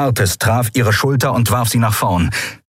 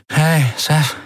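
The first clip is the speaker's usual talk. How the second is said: higher-pitched murmur through injured lips; 8 semitones higher than usual. A narrator's reading. Beaten Endriel greets Seth, murmuring. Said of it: "murmelte sie mit blutigen Lippen".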